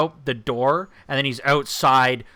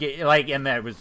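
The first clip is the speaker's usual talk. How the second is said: ordinary talk, nothing marked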